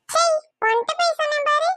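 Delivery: high-pitched mimicking huff; mocking echo. Echoes the huffy line back.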